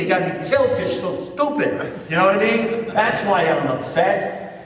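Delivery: worked-up, high-pitched protest; indignant rant. Retold or imitated. Pleading his case to his sponsor, indignant. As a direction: argue your case in a rising, exasperated pitch.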